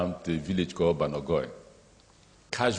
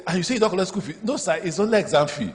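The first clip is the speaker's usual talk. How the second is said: 1 indignant interrogation; 10 semitones higher, 3 dB louder than usual. Retold or imitated. Governor interrogating the headmaster.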